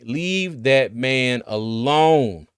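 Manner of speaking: very slow, drawn-out insistence; emphatic plea. Real, not acted. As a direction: stretch every word to make it land. Pleads with fans to stop.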